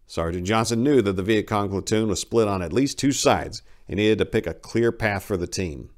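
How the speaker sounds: plain reading voice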